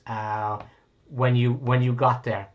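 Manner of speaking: normal talking voice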